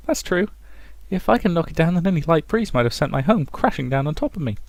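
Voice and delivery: put-on high, smug old lady voice; prim old dame, pleased with herself; old woman voice. Elf agrees smugly, same voice.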